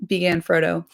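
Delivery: ordinary delivery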